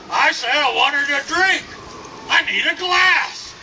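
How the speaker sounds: high, loud holler across a bar; demanding shout. Acted. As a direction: bellow for service like a huge cowboy. Huge man demanding a glass.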